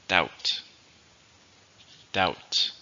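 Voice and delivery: "doubt" as one syllable, the B silent. Shown as a demonstration. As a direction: model "Doubt" as "dowt", with no B sound. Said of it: "there's no B".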